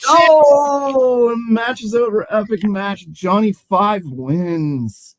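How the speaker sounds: loud, high cry easing into a slow winner call; big end-of-match announcement; excited ring announcer. Acted. Game master announces match winner.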